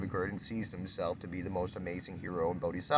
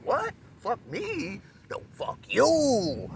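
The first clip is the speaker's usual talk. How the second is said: high-pitched, upset outburst getting louder and slower on "No, fuck you!"; a high, offended squawk that blows up into a slow shout; all upset; 14 semitones higher, 5 dB louder, much slower than usual. Retold or imitated. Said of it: "You know, all upset".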